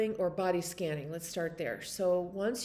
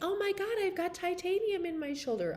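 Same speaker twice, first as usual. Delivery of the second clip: much higher, mock astonished exclamation; surprised client; 11 semitones higher than usual. Retelling a client's shocked reply, high and surprised. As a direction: pitch it way up like a startled woman. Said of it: "She's like".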